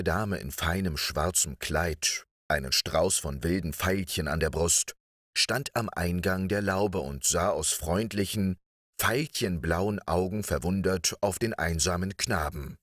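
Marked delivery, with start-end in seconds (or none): none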